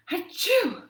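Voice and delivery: high, loud hammed-up achoo; cartoon sneeze; big fake sneeze. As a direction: throw a huge theatrical sneeze. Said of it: "a pretty dramatic reading".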